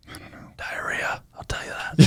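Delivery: hushed unvoiced whisper. Real, not acted. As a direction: whisper it like a secret.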